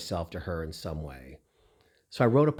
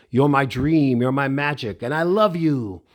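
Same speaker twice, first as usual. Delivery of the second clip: louder, higher, grand singer's declaration; 4 semitones higher, 6 dB louder than usual. Retold or imitated. Stage star to a fan holding her hands, grand impersonation. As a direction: proclaim it like a stage star to an adorer. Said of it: "in that [name] voice".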